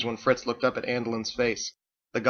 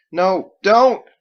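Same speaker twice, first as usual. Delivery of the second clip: frightened plea; 9 semitones higher, 13 dB louder than usual. Acted. Drake begs armed woman.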